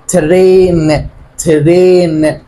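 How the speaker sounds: ترين very slow with the ay sound; reading train aloud. Shown as a demonstration. Teacher reading ترين slowly for the class. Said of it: "طب هنا بقول ايه؟".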